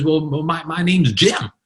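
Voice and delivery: high, eager imitated introduction; lit up. Upbeat storm survivor answering a reporter, lit up. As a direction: play the survivor bursting to answer. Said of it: "this guy was lit up".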